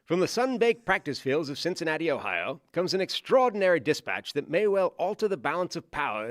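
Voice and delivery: put-on old newsreel announcer in Mid-Atlantic accent; grand 1940s newsreel read, Mid-Atlantic accent. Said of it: "we gotta break out the accent".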